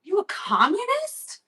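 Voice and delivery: high-pitched, incredulous question; joking accusation. Retold or imitated. Vlogger jokingly accusing her olive-hating friend, mock outrage. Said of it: "I'm joking".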